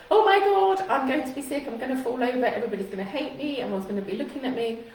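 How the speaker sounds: high panicky outburst speeding into a worried rush; mock panic, loud start then tumbling out fast; anxious racing thoughts. Coach acts out a speaker's worrying thoughts, panicky and rushed. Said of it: "your brain isn't going off on tangents".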